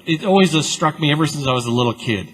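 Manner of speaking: normal talking voice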